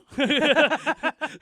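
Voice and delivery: high, loud cackling laugh; a sudden loud laugh; loud burst of laughter. Real, not acted. Big laugh after "No.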